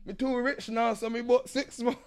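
high, loud mock Jamaican patois brag; hammed-up Jamaican patois boast; Jamaican patois accent. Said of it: "He said".

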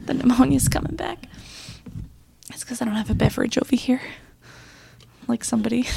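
strained breathy whisper from a sore throat; hoarse whisper. Real, not acted. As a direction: voice almost gone, barely whispering. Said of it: "Salt room still coming through".

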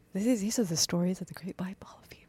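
spooky whisper. Pretend. Mock podcast narrator whisper.

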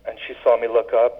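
ordinary talk

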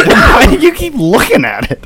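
high and laughing, almost crying. Real, not acted. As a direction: ask it through tears of laughter. Laughing at friend.

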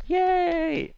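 high-pitched imitated family cheer; imitating family cheer. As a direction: squeal it like a delighted child. Family cheering his magic.

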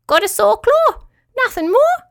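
very high tiny squeaky voice; small creature piping up; high squeak; squeaky crayfish. Acted. Crayfish character voice.

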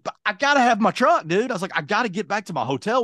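high-pitched pleading protest; retold urgent plea. Stranded customer begging tow truck guy, urgent and high.

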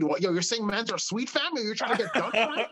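a Scarborough guy angered at being called sweet, Toronto slang. Pretend. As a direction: take offense hard in a high Scarborough voice. Roadman takes offense.

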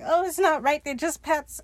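high-pitched tearful protest; mock crying. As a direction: imitate someone starting to cry. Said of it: "And he starts crying".

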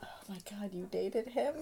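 hushed, whispery disbelief; soft incredulous gasp of a question; aghast aside. Acted. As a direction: whisper it to a friend, scandalised. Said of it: "Sunshine is looking at Sugar like".

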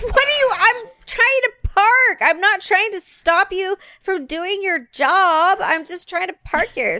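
high, loud whining protest; exasperated whine. Retold or imitated. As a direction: plead it like she's wronged.

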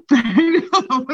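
laughing while saying it. Real, not acted. Comic admitting her Roseanne streak, laughing. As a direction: giggle through the admission.

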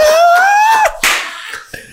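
loud high laughing shriek; a real laugh that rises to a shriek. Real, not acted. Laughing shout after outburst.

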